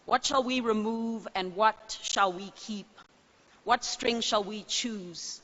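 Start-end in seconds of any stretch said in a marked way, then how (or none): none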